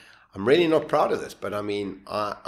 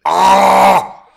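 loud open-mouthed wail; wordless yell; 10 semitones higher, 15 dB louder than usual. Shown as a demonstration. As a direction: try to shout words with a useless tongue. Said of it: "This is what came out of my mouth".

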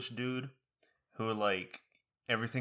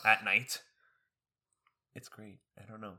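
ordinary talk, nothing marked

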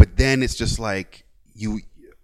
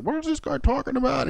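put-on confused old man; 13 semitones higher, 5 dB quieter than usual. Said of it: "he's like".

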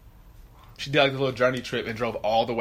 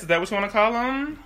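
normal talking voice

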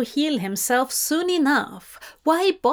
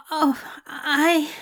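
stammered; 5 semitones higher than usual. A narrator's reading. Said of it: "the angel stammered".

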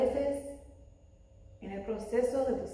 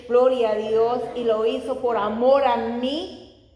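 louder, driving sermon delivery; impassioned preaching; 2 semitones higher, 7 dB louder than usual. Real, not acted. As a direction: preach it with building conviction. Preaches with rising force. (predicación apasionada)

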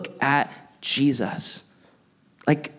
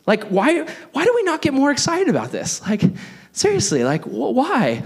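much higher, fast and excited; 10 semitones higher than usual. Real, not acted. Pastor thrilled about heaven: high excited outburst. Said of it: "I'm so excited".

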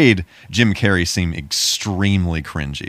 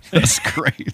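very high, squealing through a laugh; cracking up, barely getting it out; laughing hard; 13 semitones higher than usual. Real, not acted. Cohost cracking up over the movie's absurd moment, laughing hard.

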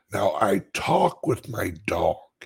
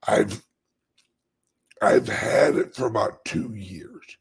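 normal talking voice

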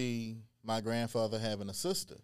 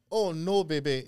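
put-on French accent; 5 semitones higher, 5 dB louder than usual. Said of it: "in a French accent and said".

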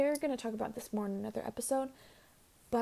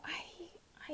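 whispering; 6 semitones higher, 17 dB quieter than usual. Real, not acted. Confessing quietly.

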